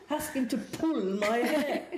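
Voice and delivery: put-on high, drawn-out French-accented demand; French client. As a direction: mimic an insistent French lady, laughter creeping in. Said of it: "she's saying in French".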